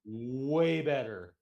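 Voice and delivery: "Way" stretched long and slow, then "better"; emphatic WAY. Real, not acted. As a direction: drag out "Way" to insist on it. Repeats it to insist.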